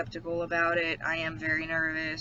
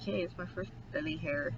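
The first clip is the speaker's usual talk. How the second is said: quietly, a softer put-on little voice; soft mock greeting; 9 dB quieter than usual. Talking fondly to her first belly hair, softer. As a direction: greet the hair softly, like a new pet. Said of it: "And I'm like".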